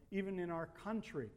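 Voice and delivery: usual speaking voice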